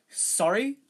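high, loud rising query; puzzled. A narrator's reading. Lockhart not following Harry, puzzled. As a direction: ask it with a big upward lift. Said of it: "said Lockhart, looking puzzled".

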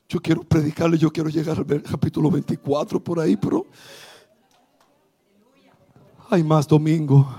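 low, soft preaching voice; quiet sermon. Real, not acted. Pastor speaking softly to the congregation, low and quiet. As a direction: draw them in softly. Softer, lower preaching stretch.

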